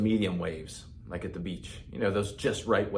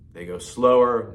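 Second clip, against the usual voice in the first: ordinary talk, nothing marked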